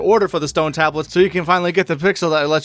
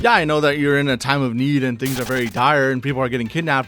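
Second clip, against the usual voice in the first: ordinary delivery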